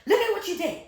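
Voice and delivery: high, loud accusing yell with a laugh; mock the mother shoving her daughter forward, yelling; furious mother. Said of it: "she was like".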